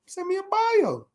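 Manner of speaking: high slow teasing repeat of the request; high drawn-out mock. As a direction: stretch it out high like a mock demand.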